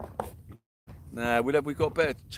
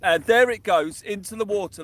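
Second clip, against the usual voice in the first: higher and louder as the line splashes in; excited cast call; 7 semitones higher, 9 dB louder than usual. Real, not acted. Angler cheering his own cast as it hits the water, excited. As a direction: call the cast like a big moment.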